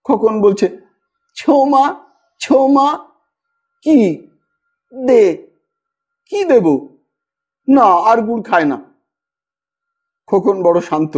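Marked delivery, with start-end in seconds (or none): 1.4-8.8 lightly voiced small child, higher and babbling; a little boy lisping for his aunt; toddler voice. A narrator's reading. (ছোট শিশুর আধো আধো গলা)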